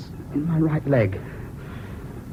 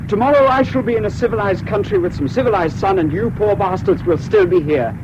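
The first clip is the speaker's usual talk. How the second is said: loud, high triumphant gloat, much louder and higher; gloating farewell; 9 semitones higher, 6 dB louder than usual. Acted. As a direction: crow it over them, savouring your escape. Gloating farewell to those left behind.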